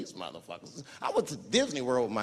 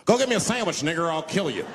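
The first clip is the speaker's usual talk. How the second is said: higher, loud threatening order; mock slave-owner bark; 4 semitones higher, 6 dB louder than usual. George Washington ordering the comic around, loud threat.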